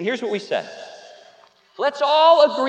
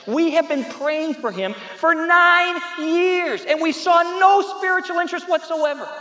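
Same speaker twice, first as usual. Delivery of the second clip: high, raised voice hammering each word; impassioned outburst; 7 semitones higher, 4 dB louder, slower than usual. Retold or imitated. Sister tells pastor of nine fruitless years, impassioned. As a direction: build to punched, separate words of exasperation. Woman's emotional outburst, retold.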